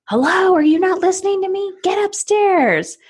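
exasperated parent barking an order upstairs; irritated parent. Retold or imitated. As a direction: snap at the child to go upstairs. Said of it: "The energy's off".

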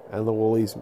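ordinary delivery

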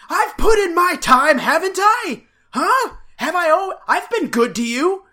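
high, loud indignant demand; pushy ranting. Retold or imitated. Andy demanding marriage from Ellie, high and loud mock indignation. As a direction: hammer it home like he's owed. Mocking Andy's proposal.

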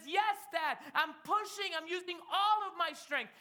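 put-on high, loud protesting child; acted straining, upset kid; frustrated little boy. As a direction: insist to Dad at the top of your effort. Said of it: "he begins to cry and say".

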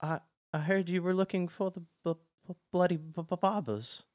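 high, stammering timid voice; nervous, scared underling. Acted. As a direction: stutter nervously, stuck on the b sounds. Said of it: "he's so nervous and".

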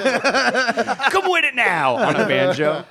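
mock heckler. Joining the mock voice.

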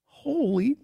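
high-pitched mock exclamation; mock shock. Son reacts to mom picking Dave, high mock shock. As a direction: squeak out the shock. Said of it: "And I go".

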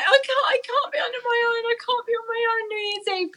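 high, crying plea not to be left alone; half-sobbing, begging not to be left; tearful pleading. Retold or imitated. Retelling labour, begging the nurses in a mock cry.

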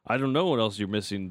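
ordinary talk

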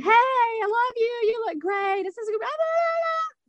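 high, slow, gushing praise; gushing mom voice. Retold or imitated. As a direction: coo it like a proud mama. Mama and friend gushing comments.

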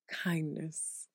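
whispered, hushed and reverent; breathy awed whisper. Shown as a demonstration. Friend whispering the word in awe, hushed. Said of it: "I go".

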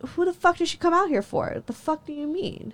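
high-pitched retold disbelief; incredulous comeback. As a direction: challenge him in high, offended disbelief. Acting out her angry comeback.

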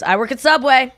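imitated employee proclamation; announcing it. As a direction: announce it to the whole shop. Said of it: "you have to announce it".

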